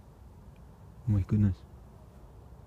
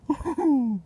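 surprised laugh. Real, not acted. Sees worms touching.

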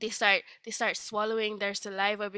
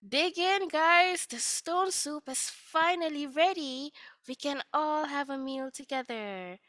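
higher, louder calling out; joyful call; 6 semitones higher, 4 dB louder than usual. Acted. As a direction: call the villagers in cheerfully. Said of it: "the traveler said".

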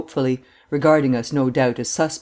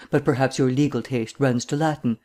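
ordinary delivery

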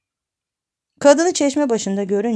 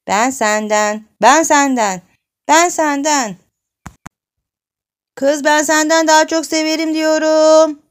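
louder and higher with each repeat; escalating childish insistence; 8 semitones higher, 7 dB louder than usual. Acted. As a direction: insist like a stubborn child, building to a yell. Çocuklar inatla atışıyor. (giderek yükselen çocukça inatlaşma)

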